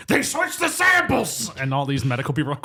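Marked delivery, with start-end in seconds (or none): 0.1-1.5 high, slow, drawn-out mock shout; Harrison Ford yelling. Podcaster imitating an actor bursting into a seminar, yelling. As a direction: stretch it into an over-the-top accusing holler. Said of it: "Harrison Ford bursting into a seminar and just yelling".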